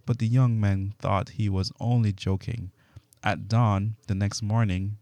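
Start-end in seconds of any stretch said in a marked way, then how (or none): none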